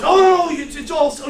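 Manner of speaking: high, loud mock protest; flustered protest. Client protesting to his advisor, high flustered imitation.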